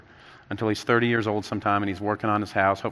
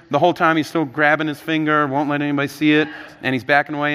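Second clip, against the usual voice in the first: usual speaking voice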